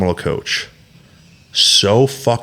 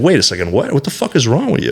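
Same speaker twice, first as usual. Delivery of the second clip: high, indignant wife's outcry; the wife hitting back at a grudge; retold shocked outburst; 6 semitones higher than usual. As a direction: shoot the pitch up in disbelief. Voicing wife's reaction, high pitch.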